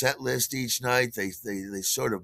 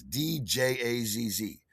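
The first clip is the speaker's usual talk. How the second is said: ordinary talk, nothing marked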